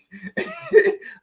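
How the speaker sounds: high-pitched helpless giggle; real amused giggle mid-sentence; giggling. Real, not acted. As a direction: break off into a high laugh. Laughs mid-sentence at own joke.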